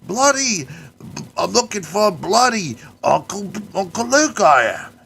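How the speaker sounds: high, loud hammed-up Australian accent; Australian caricature. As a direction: play a cartoon Aussie wrestler. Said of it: "they sadly had to play it like a caricature".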